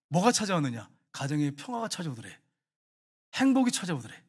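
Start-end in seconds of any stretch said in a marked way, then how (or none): none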